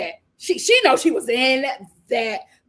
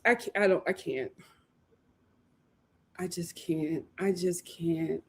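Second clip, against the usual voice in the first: trailing off; 7 semitones lower, 9 dB quieter than usual. Real, not acted. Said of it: "can't cope with it".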